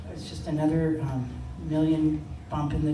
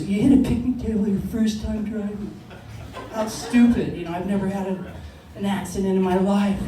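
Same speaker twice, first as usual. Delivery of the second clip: louder, scornful scolding; mildly mocking anger; 3 semitones higher, 5 dB louder than usual. Angry sister to younger sibling after crash, mocking scold. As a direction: scold like a disgusted big sister. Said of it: "she's mad at me".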